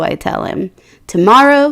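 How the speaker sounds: ordinary delivery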